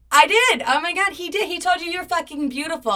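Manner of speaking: a scandalized gasp of fake delight; mock outraged disbelief. Retelling the girl's confirmation with loud mock outrage.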